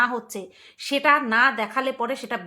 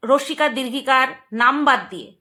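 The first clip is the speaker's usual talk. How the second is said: ordinary delivery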